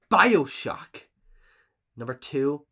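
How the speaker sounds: normal talking voice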